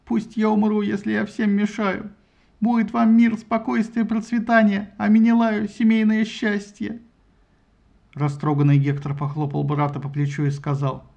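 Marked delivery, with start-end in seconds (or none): 0.0-7.0 tearful outburst. A narrator's reading. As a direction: voice raised through tears. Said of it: "расплакался".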